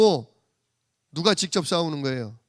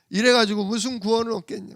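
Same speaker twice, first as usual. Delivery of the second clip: ordinary talk, nothing marked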